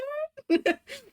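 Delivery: shrill squeal of a laugh; high squealing laugh. Real, not acted. Streamer laughing at her friend wanting the tattoo, high squeal.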